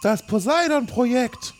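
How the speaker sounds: hohe, langsame Forscherinnenstimme; hohe Figurenstimme. Acted. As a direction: spricht wie eine feierliche Wissenschaftlerin. Doktor Ye in nacherzählter Filmszene. (hohe verstellte Stimme, langsam)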